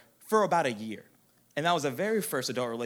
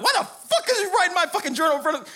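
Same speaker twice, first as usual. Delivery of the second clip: high, loud, laughing outrage; angry rant; 8 dB louder than usual. Retold or imitated. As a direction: yell it in disbelief. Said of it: "what I was talking about the entire ride".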